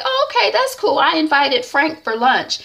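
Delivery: high, bright oblivious cheer; cheerful. Acted. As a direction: chirp it out innocently. Husband cheerfully inviting Frank.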